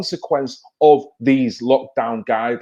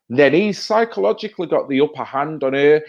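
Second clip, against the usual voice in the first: ordinary talk, nothing marked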